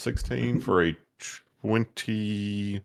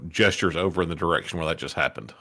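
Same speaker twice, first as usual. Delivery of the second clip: ordinary talk, nothing marked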